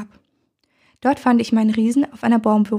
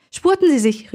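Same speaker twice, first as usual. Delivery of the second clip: urgent call, pitched up; 5 semitones higher than usual. A narrator's reading. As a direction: call after him to hurry. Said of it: "rief ich".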